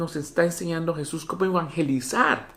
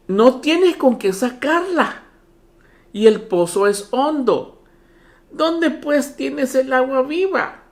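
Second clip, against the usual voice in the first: higher, louder, slow sarcastic jab; mock her doubt, drawn out and scornful; a woman sarcastically questioning Jesus; 7 semitones higher, 4 dB louder, slower than usual. Shown as a demonstration. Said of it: "le dice a Jesús de una forma sarcástica".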